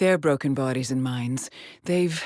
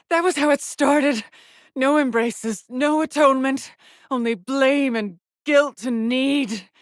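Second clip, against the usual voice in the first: loud, high, bitter anguish; anguished outburst; 8 semitones higher, 5 dB louder than usual. Acted. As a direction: hurl it out, slowing at the end. Bitter outburst of blame and guilt.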